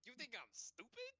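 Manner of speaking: high, indignant squawk; indignant guardian. Acted. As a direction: squawk it in offended disbelief.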